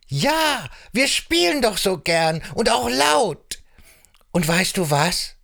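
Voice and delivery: high, bright little frog voice, thrilled; excited frog. Acted. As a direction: squeak out your thanks like a happy frog. Same frog character.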